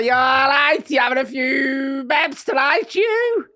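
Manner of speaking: high, loud, slow camp Northern voice; chirpy drag queen at the bar; high loud camp Northern bar greeting; Danny impression. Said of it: "That's what they said to me".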